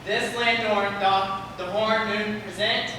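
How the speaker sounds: a hint of a stiff player; lightly voiced moonshine. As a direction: recite it like a nervous amateur. Moon actor declaiming.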